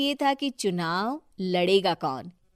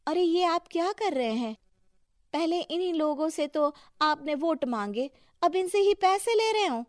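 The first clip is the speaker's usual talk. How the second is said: rising, louder, shocked scolding; indignant protest; 7 semitones higher, 4 dB louder than usual. Acted. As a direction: call out the husband for taking bribes. Wife confronts husband over bribe. (पति को रिश्वत लेते देख गुस्से में टोकती पत्नी)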